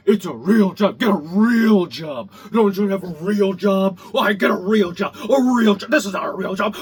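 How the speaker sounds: loud sneering mock of critics; mocking quote. Mocking critics who say get a real job. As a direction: parrot them with loud scorn. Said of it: "but my favorite, it's".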